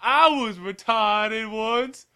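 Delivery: very slow, high Southern drawl; hammed-up Southern yokel, stretching every word. Man confessing to a horse, exaggerated Southern drawl.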